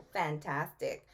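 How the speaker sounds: ordinary delivery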